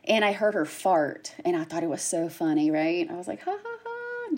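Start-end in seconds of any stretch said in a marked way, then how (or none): 3.4-4.4 high, soft mock giggle; light teasing ha-ha-ha; joking fake laugh. Nurse joking with a little girl, fake laughing. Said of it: "just joking with her".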